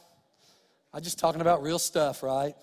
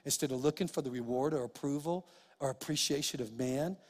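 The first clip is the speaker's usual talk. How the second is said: gentle God; 2 semitones lower, 7 dB quieter than usual. Retold or imitated. God reassuring Troy, soft and calm. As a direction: speak it kindly, like a patient father. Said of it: "He said".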